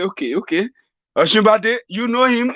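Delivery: put-on confused old man. Confused Buhari asking whether the aide knows Oshibade. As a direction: squeak the question up high, muddled.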